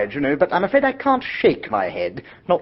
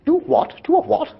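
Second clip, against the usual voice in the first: sharply higher, incredulous double take; baffled surprise; 12 semitones higher than usual. Acted. Bertie startled by his aunt's order, baffled and incredulous. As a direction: jump up in pitch, unable to believe the request.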